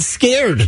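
"scared" with an air vowel; standard SCARED. Retold or imitated. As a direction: rhyme "scared" with cared. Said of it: "she's not saying skirt anymore".

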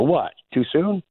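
high, slow, teasing question; a coach's teasing fake innocence; mock innocent quip. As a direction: play innocent after a cheeky joke. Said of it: "and he'd go".

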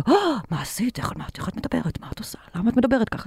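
imitating scandalized people, dropping to a whisper midway; mock shocked reaction. People scandalized hearing anal sex named aloud, mock shocked whisper. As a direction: gasp at her like she said something forbidden.

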